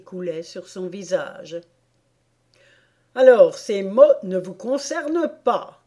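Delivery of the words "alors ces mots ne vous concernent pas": louder, higher, pleased royal remark; smiling king. A narrator's reading. The king to the queen, smiling and projecting. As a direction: say it with an audible smile, projected. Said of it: "dit le roi avec un sourire".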